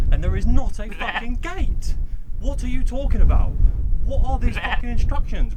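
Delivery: losing it at the useless instructions; real frustration building to a shout; frustrated rant. Real, not acted. Frustrated rant about bad instructions.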